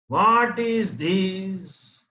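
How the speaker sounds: put-on slow, drawn-out teaching question to a toddler; mother quizzing baby. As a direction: ask it slowly like a mother teaching. Mother quizzing baby on body parts.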